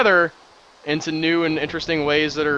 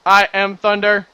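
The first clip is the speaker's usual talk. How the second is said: put-on higher, louder, slow booming toddler proclamation; kid shouting he is thunder; 7 semitones higher, 10 dB louder than usual. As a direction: play the little boy roaring it out. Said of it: "going around the house saying".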